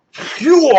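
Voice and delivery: sharp high whoosh of a blade; whoosh sound. Retold or imitated. Fan recapping the show imitates the blade with a whoosh. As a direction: mimic the blade swinging through.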